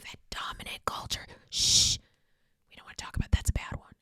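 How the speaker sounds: conspiratorial whisper, hushing; whispered aside shushing herself; mock whisper. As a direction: hush it like a forbidden secret. Mock secret about forbidden topic.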